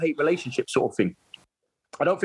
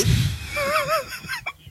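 real high-pitched laughing fit; helpless laughter. Real, not acted. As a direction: crack up mid-story. Cracking up at his own story.

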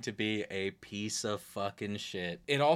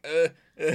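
loud, high pained grunts; flinching grunts; 7 semitones higher, 9 dB louder than usual. Retold or imitated. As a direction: grunt like a ball just hit you. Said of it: "They're like".

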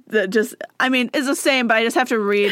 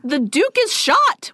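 slow, higher mock cry; mock scream; 8 semitones higher, slower than usual. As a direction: read the witnesses' cry, slowed and raised. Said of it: "witnesses screamed".

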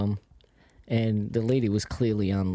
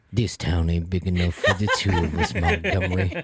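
low hammed-up Western gunslinger; Red Dead voice; 5 semitones lower than usual. Shown as a demonstration. Showing off his Red Dead voice to co-hosts, mock gunslinger challenge. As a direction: put on a lower cowboy-game voice. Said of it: "Wanna hear my Red Dead voice?".

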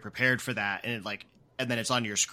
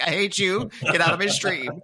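higher, playful indignant outburst; mock outrage; 6 semitones higher, 5 dB louder than usual. Streamer kicking out the game's developer, mock outraged. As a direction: jokingly throw them out, voice raised.

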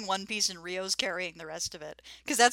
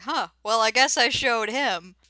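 loud, higher mock boast; self-satisfied Rio; 4 semitones higher, 7 dB louder than usual. Host voices oblivious Rio after the bear flees, smug. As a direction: puff it up like an oblivious hero.

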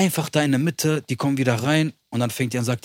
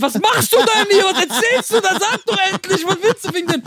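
mock shrill judge. Imitating the judge scolding him in a high mocking voice. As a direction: squeal it up like a fussing judge.

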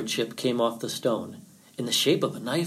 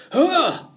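loud, high yelp; sudden yell of shock; pained yelp; 8 dB louder than usual. A narrator's reading. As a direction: yelp out as if just cut. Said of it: "yelled Rabbit".

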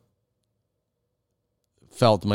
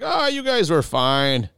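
higher, slow, dismissive buddy; imitating Jerry; 8 semitones higher, slower than usual. Jerry dismissing the couple's scare, slow, high and breezy. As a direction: brush it off lazily up high. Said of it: "He's like".

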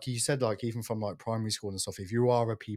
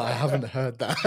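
higher, louder, laughing through the words; real laughing; 5 semitones higher, 6 dB louder than usual. Real, not acted. Interviewer to guest on long-forgotten cigarette-sharing slang, laughing. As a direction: crack up while talking.

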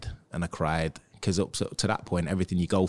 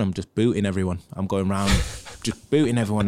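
ordinary talk, nothing marked

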